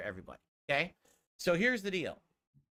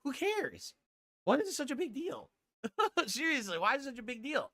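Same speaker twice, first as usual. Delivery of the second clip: high, strained protest with the voice cracking; 10 semitones higher than usual. Real, not acted. Streamer insisting hair loss is no big deal, voice cracking. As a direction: let the voice jump high and crack. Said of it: "how his voice cracked like that".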